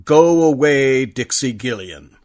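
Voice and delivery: ordinary delivery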